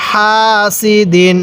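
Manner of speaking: slow, syllable by syllable হাসিদিন; slow recitation. Shown as a demonstration. Teacher reading the word slowly for students to copy. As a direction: draw each syllable out carefully. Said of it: "কিভাবে পড়ব?".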